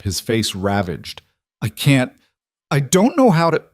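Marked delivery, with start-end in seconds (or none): 1.6-3.6 higher, louder, voice cracking into tears; a grieving father losing control; father breaks down over his dead wife; breaking down. A narrator's reading. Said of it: "he broke down again".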